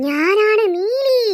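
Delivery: slow, drawn-out ghostly announcement; menacing ghost Neeli. Acted. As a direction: stretch the name out eerily.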